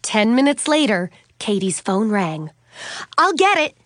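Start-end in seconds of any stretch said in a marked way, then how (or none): 3.2-3.8 high, loud call; shouting. A narrator's reading. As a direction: call out to the whole house. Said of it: "Katie shouted".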